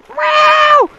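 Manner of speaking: put-on high squeaky kid yelp; high son voice. A son squeaks at his dad. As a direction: squeak it like a little boy.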